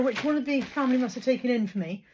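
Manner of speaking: normal talking voice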